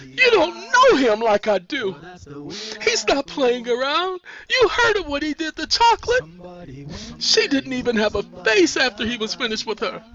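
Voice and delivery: high, tearful urgency; frightened warning. A narrator's reading. Sandra warning Nisi about Henrik, frightened. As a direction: make her believe the danger, desperately.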